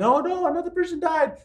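high-pitched, hammed-up alarm; exaggerated freaked-out wail; mock panic. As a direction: imitate a fretful news watcher in a high voice. Mimics panicked news watchers online.

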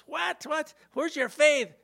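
high-pitched startled retort, acted; roused Jesus. Jesus woken by scared disciples, indignant and high-pitched. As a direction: play someone woken up and scolding.

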